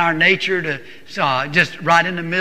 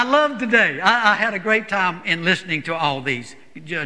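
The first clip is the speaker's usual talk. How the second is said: ordinary talk, nothing marked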